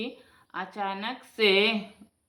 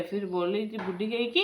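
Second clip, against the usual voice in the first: ordinary delivery, nothing marked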